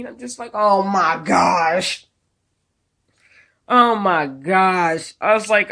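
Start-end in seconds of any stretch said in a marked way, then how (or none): 0.5-5.1 exasperated disbelief. Retold or imitated. Hearing she wants a cop.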